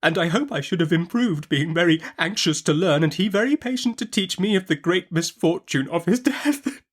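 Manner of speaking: high, tearful breaking voice; a mild break into tears. Acted. Widowed mother to Betsy, voice breaking. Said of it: "My mother broke down again here".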